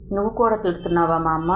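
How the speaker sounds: ordinary delivery, nothing marked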